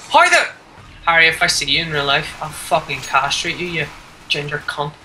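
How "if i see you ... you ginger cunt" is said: ordinary talk, nothing marked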